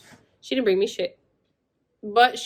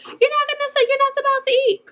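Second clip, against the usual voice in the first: much higher mock voice of a fussy mother; imitating mom; 3 dB louder, faster than usual. Mom telling her laboring daughter she can't eat, high mocking voice. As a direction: squeak it out like a prim, rule-quoting mom. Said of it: "She's, like".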